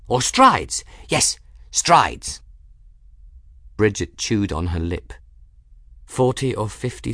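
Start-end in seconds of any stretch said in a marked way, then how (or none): 0.1-2.4 high, louder, decisive little voice; emphatic character voice. A narrator's reading. Folly settles on strides as a measure, high and emphatic. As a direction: pounce on the word with high emphasis.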